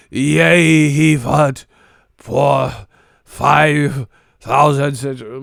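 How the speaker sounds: loud, slow, grand fake herald; hammed-up medieval announcer; mock Old English voice. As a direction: draw it out like an ancient proclamation. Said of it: "talking in fucking old English".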